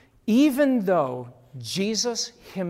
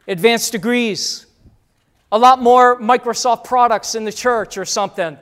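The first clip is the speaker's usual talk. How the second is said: mocking loud quote; 4 semitones higher, 11 dB louder than usual. Preacher mocking cash and marketing in church, loud sarcasm. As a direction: ham up the fake verse with scorn.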